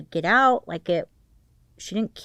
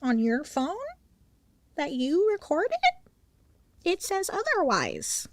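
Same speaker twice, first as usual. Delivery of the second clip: rising higher and higher, gotcha tone; smug investigator; 7 semitones higher than usual. Retold or imitated. Investigator catching a suspect in a lie, pitch climbing. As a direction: savor the gotcha like a smug detective. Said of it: "they're like".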